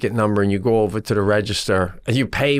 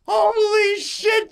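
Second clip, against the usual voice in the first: very slow, much higher, falling squeal; drawn-out squeal; much slower than usual. Retold or imitated. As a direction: stretch it out in awe, slowly. Excited at the working scooter.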